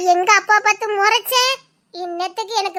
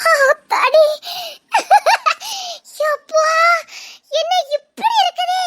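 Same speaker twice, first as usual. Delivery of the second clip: much higher, crying out in wonder; amazed; 8 semitones higher than usual. Acted. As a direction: shriek of amazement at the sight. Amazed by the view.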